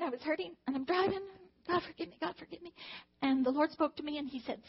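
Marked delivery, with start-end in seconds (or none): 1.7-2.7 retold tearful plea. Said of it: "I was crying".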